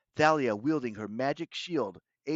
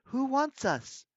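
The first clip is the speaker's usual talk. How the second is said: put-on high, drawn-out girl's demand; 12 semitones higher, 3 dB louder, slower than usual. A narrator's reading. Bianca confronting her captor, high-pitched demand. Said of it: "Bianca demanded".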